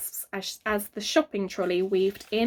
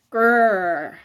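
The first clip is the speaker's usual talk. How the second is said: growl; 6 dB louder than usual. A narrator's reading. Fing growls at the sight of the biscuits. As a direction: rumble a warning growl. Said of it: "came a growl".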